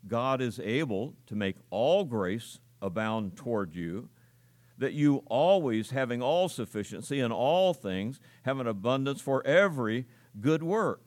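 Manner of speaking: deliberate emphasis on "all", "always", "every"; stress on all, always, every. Shown as a demonstration. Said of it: "I emphasize five different words".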